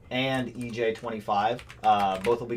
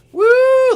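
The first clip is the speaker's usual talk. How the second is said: high loud woo; cheer; 14 dB louder than usual. Real, not acted. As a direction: whoop it out. Cheering on livestream.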